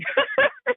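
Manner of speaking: bright squeal of a laugh; happy high laugh. Real, not acted. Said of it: "I can hear your smile".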